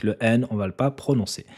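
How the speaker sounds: ordinary delivery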